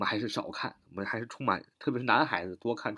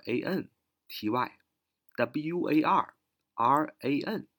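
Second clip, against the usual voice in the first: slow spelling; slower than usual. Shown as a demonstration. Teacher spells word letter by letter.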